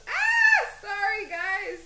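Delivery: loud, high sudden yelp; 1 startled cry. Real, not acted. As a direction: jump at the surprise. Startled by something.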